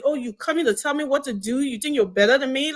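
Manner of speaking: ordinary talk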